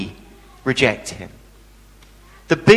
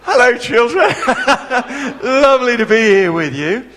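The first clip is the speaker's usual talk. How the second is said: high, loud, laughing hello; nervous cheery greeting; 5 semitones higher, 3 dB louder than usual. Retold or imitated. Speaker greets a hostile crowd, nervous laughing cheer. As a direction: brave it out with an awkward laugh. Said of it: "walked out and went".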